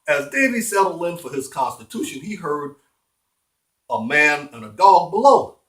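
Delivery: ordinary delivery, nothing marked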